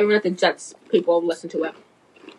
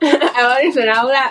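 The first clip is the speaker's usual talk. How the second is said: giggling through the words; laughing; 4 semitones higher than usual. Real, not acted. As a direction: laugh while repeating it. Laughing repeat of friend's words.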